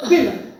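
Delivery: "women" said WIM-in; dictionary pronunciation. Shown as a demonstration. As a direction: say "women" with a short I in the first syllable. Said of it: "the dictionary and the native speakers".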